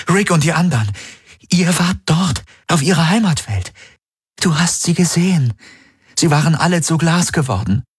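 high, rising, desperate plea; pleading young woman. Acted. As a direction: beg him to remember. Kirai keeps pleading.

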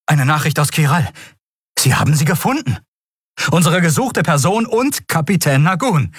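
high-pitched, excited announcement; eager report. A narrator's reading. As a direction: report the find urgently to your superior. Offizier meldet lang ersehnten Fund. (aufgeregte, hohe Meldung)

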